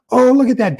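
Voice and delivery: a sudden high, loud "oh" of delight; light up at what you just saw; surprised. Real, not acted. Surprised at a viewer comment.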